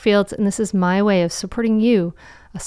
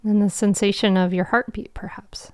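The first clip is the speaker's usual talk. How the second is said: guided meditation. Acted. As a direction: guide them into calm, with long pauses.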